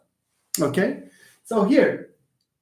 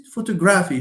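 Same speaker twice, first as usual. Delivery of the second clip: Photography punched on GRA; Italian-sounding mispronunciation; 2 semitones higher, faster than usual. Shown as a demonstration. Teacher teases a wrong stress, Italian-like. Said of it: "You are Italian".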